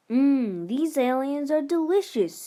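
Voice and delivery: loud, high, savouring mmm; gleeful yum. Pretend. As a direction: relish the pancake like a treat. Child eats pancake gleefully.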